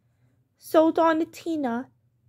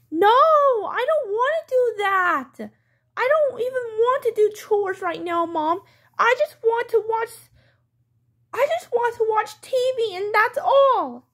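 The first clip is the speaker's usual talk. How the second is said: put-on higher-pitched, stubborn kid protest; whining child; 4 semitones higher than usual. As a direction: whine back at mom, drawing out the refusal. Child protests against doing chores.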